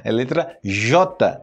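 "É letra J" slow, each word stressed; slow firm correction: "letra J"; slow emphasis J. Shown as a demonstration. Teacher firmly correcting students, slow and deliberate. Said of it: "não fale letra X".

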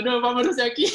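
a laughing imitation of his wife begging him not to go in; imitating wife. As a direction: mimic the panicked wife while cracking up. Imitating wife wanting to leave.

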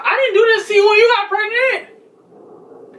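high, drawn-out heated protest. Real, not acted. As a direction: throw it back indignantly, pitch way up. Heated quarrel over pregnancy.

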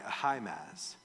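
normal talking voice

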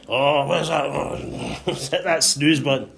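low, soft, half-asleep mumble; drowsy, slowing mock grumble; groggy sleeper. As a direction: play someone fumbling for the snooze button. Acts sleepy person hitting snooze.

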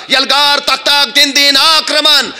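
high-pitched, rousing war cry; furious commander ordering the charge, drawn out at the end; enraged battle cry. Acted. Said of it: "तिकडून बहादूर खान संतापलेला". (संतापलेली युद्धगर्जना)

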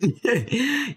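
high-pitched laugh bursting out; high burst of laughter. Real, not acted. Player cracks up at his own dialogue line. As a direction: crack up at your own joke.

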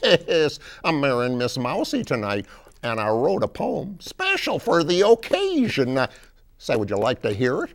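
high, loud, folksy frog character; big high-pitched frog voice; Beauregard the Frog. Acted. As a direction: introduce yourself as a proud cartoon frog. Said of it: "Beauregard the Frog".

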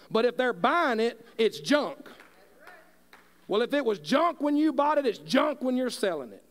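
impassioned sermon voice that keeps building; preaching at full force. Real, not acted. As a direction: preach it hard to the congregation. Preaching about loving your neighbor.

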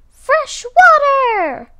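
high, very loud, drawn-out cry; joyful shout. A narrator's reading. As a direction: shout it out, stretching the words. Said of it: "he shouted".

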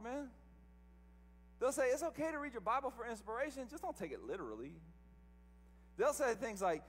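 mocking the religious crowd in a sermon; preaching. Preacher mocking religious people to his congregation, sermon voice. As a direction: preach it with a sneer at the quoted advice.

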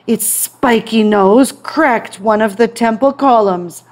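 usual speaking voice

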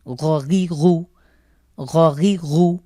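গ গি গু said as Arabic ghain from the throat. Shown as a demonstration. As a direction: rasp গ গি গু from the end of the throat. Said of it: "কণ্ঠের শেষ থেকে গইন এবং খ উচ্চারিত হয়".

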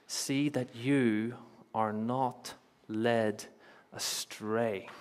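very slow, with pauses before the last words; drawn-out, measured warning; solemn repeated warning. Acted. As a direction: let each word land separately.

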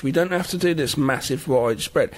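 normal talking voice

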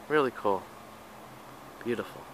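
ordinary delivery

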